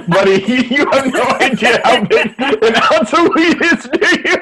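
high, laughing disbelief. Real, not acted. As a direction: laugh while asking. Laughing at Nate's question.

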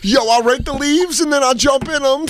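put-on high, boasting little kid. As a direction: brag like a young boy. Mocking kid nephews.

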